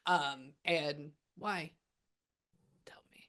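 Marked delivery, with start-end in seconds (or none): 2.9-3.3 very soft, nearly lost murmur; hushed aside. Real, not acted. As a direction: ask the spirit under your breath. Muttered aside to the spirit.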